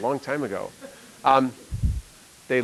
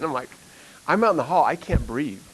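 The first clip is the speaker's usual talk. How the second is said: ordinary talk, nothing marked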